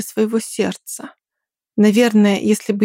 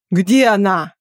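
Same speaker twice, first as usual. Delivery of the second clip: Nicholas yelling; slower than usual. A narrator's reading. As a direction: bark out where she is. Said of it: "услышала я крик Николаса".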